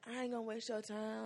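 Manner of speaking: soft, hushed sweet-talker; light whispery voice. Acted. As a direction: play the smooth player, very softly. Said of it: "the light whispery voice".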